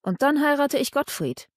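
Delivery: defiant. A narrator's reading. Mechthild telling Anselm she will marry Gottfried, defiant. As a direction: toss it out in defiance. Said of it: "erklärte trotzig".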